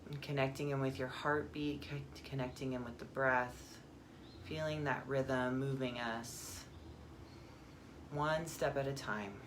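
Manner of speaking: calm meditation guide, long pauses; guided meditation. Acted. Guide turns listeners inward to the breath, calm meditation voice. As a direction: ease them into stillness, phrase by phrase.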